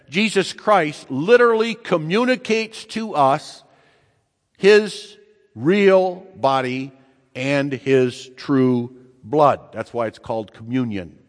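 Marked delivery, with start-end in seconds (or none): 0.1-9.6 high then slow, weighty; climactic preaching. Acted. Preacher proclaims the real body and blood, high and slow. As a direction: land each word slowly at the climax.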